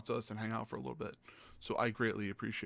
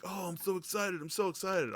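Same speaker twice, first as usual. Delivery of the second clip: put-on excited kid; 8 semitones higher than usual. Mimicking eager fourteen-year-old.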